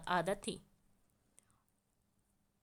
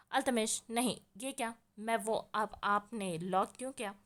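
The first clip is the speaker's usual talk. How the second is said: usual speaking voice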